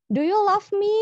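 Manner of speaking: sung-out high and loud, teasing; playful swoon. Pretend. Teacher's teasing example question to her class, high and playful. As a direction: toss it out like a flirty joke.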